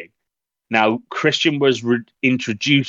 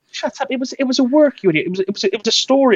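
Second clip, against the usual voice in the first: mocked coworker, much higher and scoffing; incredulous coworker; 13 semitones higher, faster than usual. Telling a coworker the wrestlers' marriage was fake, high mock scoff. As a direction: play the know-it-all scoffing at you. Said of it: "And he's like".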